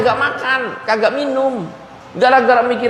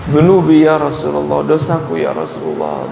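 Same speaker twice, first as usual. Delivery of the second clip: weeping confession; 7 semitones lower than usual. Acted. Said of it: "Nangis dia".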